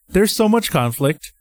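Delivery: ordinary talk, nothing marked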